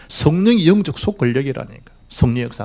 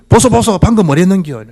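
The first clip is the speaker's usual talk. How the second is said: imitated Gyeongsang accent, pitched high; Gyeongsang dialect; 6 semitones higher than usual. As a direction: call out indignantly in Gyeongsang dialect. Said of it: "경상도 말로 뭐라 했겠어요?".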